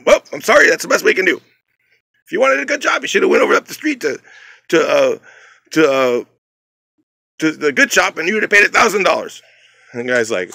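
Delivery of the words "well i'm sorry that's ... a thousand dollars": high, loud brush-off; dismissive. Retold or imitated. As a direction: wave the customer off loudly. Manager brushing off the customer.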